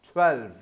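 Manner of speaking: ट्वेल्व ending on a firm v; twelve with clear v. Shown as a demonstration. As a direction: land ट्वेल्व on a crisp v sound. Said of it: "ट्वेल्व कहते हुए भी वी का".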